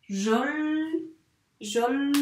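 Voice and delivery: "Jol" with held L, opening into long "Joli"; sounded-out blending. Shown as a demonstration. Teacher showing which sound is missing, drawn out. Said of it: "il manque le son I".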